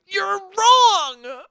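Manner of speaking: put-on high, loud, drawn-out childish yell; hammed-up indignant shout; mock angry outburst. Showing the immature reply to an argument, mock angry outburst. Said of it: "calmly go ... Instead of going".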